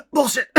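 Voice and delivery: a loud fake cough with a swear word coughed out; fake cough insult. Youtuber heckling the CEO letter, with a loud fake cough. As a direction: cough the word out, gag-style.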